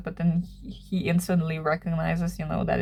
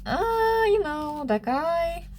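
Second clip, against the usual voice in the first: hesitant high voice; 11 semitones higher, much slower than usual. Retold or imitated. She fumbles an excuse at reception.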